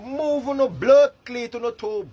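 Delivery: usual speaking voice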